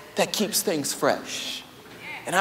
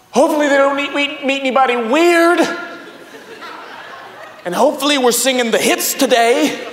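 louder, higher, laughing panicky inner voice; nervous pastor; 6 semitones higher, 7 dB louder than usual. Retold or imitated. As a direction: play your own panic, half laughing. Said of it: "I'm like".

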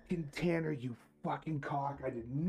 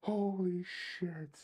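awed; 9 semitones higher, much slower than usual. Real, not acted. Awed, slow.

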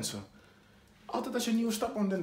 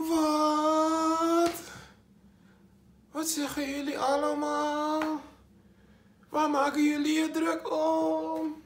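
higher, slow, put-on bewildered voice; mock puzzled; 10 semitones higher, much slower than usual. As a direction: play it dumb and slow. Puzzled reply to the fans.

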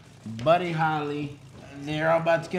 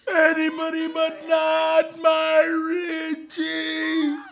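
much higher, slow sobbing shout; wailing grief; 13 semitones higher, much slower than usual. Acted. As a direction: wail it out in mock anguish. Mother wailing over dead son.

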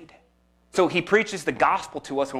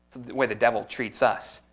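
ordinary talk

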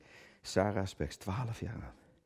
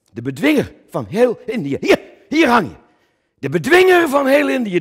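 high, loud exalted proclamation; grand hailing shout; 12 dB louder than usual. Acted. Actor pointing at Coen's portrait, shouting his title.

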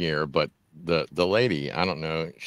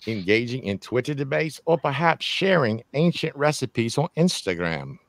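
put-on British accent, higher pitched with pauses; 7 semitones higher than usual. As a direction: read out the question in your best British accent. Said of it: "with my best British accent".

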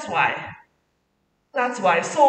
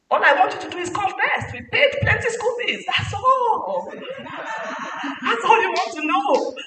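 mock parent; 9 semitones higher, slower than usual. Parent mocking school fees.

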